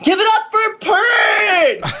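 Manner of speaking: high, slow, hammed-up stage shout; drawn-out mock announcer call. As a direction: hype the crowd like an emcee.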